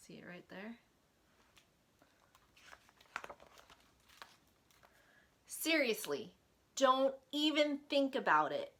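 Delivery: bright, lively storytime voice; reading to children. Acted. Librarian reading Larry's words to kids, lively storytime. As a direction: read it like a friendly children's librarian.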